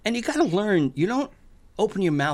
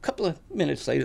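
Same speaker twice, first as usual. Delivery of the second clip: ordinary talk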